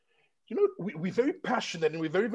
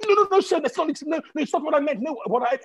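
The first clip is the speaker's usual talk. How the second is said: high, loud panicked backpedalling; flustered apology; 9 semitones higher, 4 dB louder, much faster than usual. Retold or imitated. Airport agent hastily apologising to the passenger, high and flustered. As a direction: scramble to take it back.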